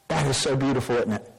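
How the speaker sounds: normal talking voice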